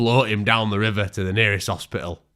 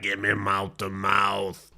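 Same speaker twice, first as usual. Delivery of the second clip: slow faint plea; 4 dB quieter, much slower than usual. Acted. Dying hillbilly's plea.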